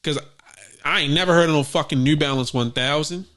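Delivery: ordinary talk, nothing marked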